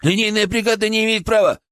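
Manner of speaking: high-pitched, alarmed objection; panicked protest. A narrator's reading. Colleague fears exceeding the crew's authority, panicked. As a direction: insist nervously on the rules. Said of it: "запаниковал Петров".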